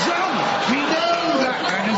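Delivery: mock revelation. Mock revelation about the Queen.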